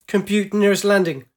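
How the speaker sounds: loud barked order; urgent command. Acted. Pilot ordering her holosphere to find landing, urgent. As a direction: bark the command at the computer.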